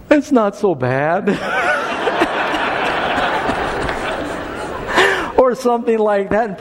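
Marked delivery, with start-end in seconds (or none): 0.1-1.3 son's words slowed and laughed through; laughing quote. Retold or imitated. Father retells his son comforting the crying girl, laughing. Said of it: "he goes". 1.3-5.3 high breathless laugh; real laughter. Real, not acted. Pastor laughing at his son's comforting words, breathless laugh.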